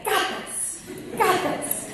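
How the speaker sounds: put-on high, loud echo of a small child; imitating little boy. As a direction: mimic an excited toddler parroting. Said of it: "I hear Elias say".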